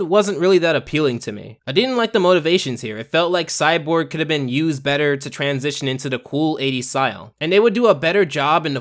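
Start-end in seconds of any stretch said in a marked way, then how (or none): none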